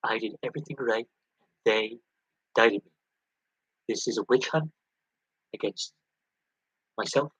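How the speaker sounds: lightly voiced Trump, boastful and repetitive; mock Trump rally boast, a hint of his cadence; Trump impression. Streamer mocking Trump for viewers, lightly voiced boast. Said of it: "my Trump impersonation is just".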